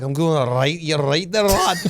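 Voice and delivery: put-on Scottish woman's burr; Scottish accent. Imagined Scottish bird answering his hello, Scottish accent. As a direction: greet cheerily in broad Scots. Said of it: "if I see a Scottish bird".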